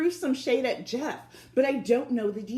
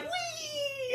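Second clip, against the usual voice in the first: gleeful whee; 9 dB quieter than usual. Retold or imitated. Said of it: "I was like".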